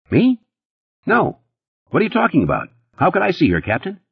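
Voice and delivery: high, jumpy denial; Eddie protesting. A narrator's reading. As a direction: squeak out an indignant no. Narrator voices Eddie, defensive.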